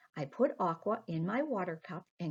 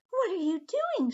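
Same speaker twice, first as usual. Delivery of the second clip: high, loud alarmed cry; shocked scream; 12 semitones higher, 5 dB louder than usual. Acted. Aqua shouting at Peter as he drops her in the sea. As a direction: scream the question in sudden shock. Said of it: "screamed Aqua".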